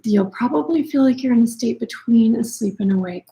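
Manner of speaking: ordinary talk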